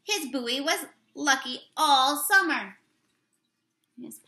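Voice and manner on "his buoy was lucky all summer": slow, louder read-aloud voice; storybook read-aloud. Acted. Teacher reading the picture book to the class, slow read-aloud. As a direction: read the page out to the children, slowly.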